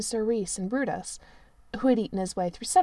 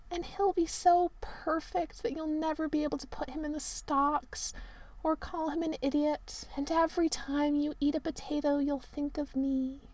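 hammed-up tragic Merlin; 6 semitones higher, 4 dB quieter than usual. A narrator's reading. Merlin moans to the prince about his cold room.

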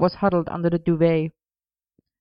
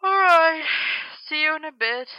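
talking through a yawn, pitched high; sleepy, high, yawned-out goodbye; huge yawn; 11 semitones higher than usual. A narrator's reading. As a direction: yawn into the words, then tail off sleepily. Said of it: "Merlin yawned hugely".

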